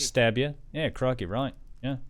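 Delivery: matey Australian accent. Shown as a demonstration. Playing along with crikey, in an Australian accent. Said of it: "there's also Australia, mate".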